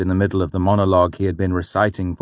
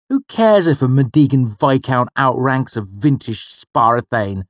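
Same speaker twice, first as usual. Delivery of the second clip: put-on a boy's exasperated protest, pitched up; 7 semitones higher, 5 dB louder than usual. The boy protesting his mother's lessons, in an exasperated voice. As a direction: push back like a bored teenager. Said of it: "I protested".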